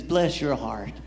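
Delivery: low, lightly voiced Southern lady; a hint of polite Southern condescension. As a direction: imitate a Southern lady's veiled put-down. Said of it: "the Southern lady says".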